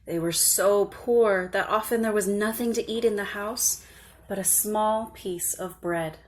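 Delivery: ordinary delivery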